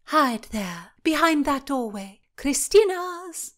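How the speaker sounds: high, loud urgent command; commanding order. Acted. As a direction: bark the order like a princess. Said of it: "Cecilia ordered".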